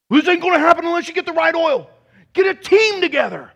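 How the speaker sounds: loud, high, zealous mock rant; hammed-up urgent rallying cry; spiritual nut. Preacher mocking a spiritual nut about the oil, loud zealous shouting. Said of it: "ever met a spiritual nut like that".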